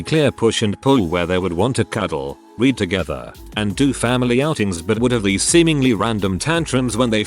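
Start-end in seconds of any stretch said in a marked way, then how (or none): none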